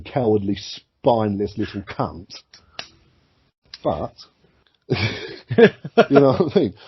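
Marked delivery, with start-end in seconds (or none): 4.9-6.7 high, slow laugh through the question; laughing it out at the end of a rant. Real, not acted. Laughing while asking his co-host if he gets it.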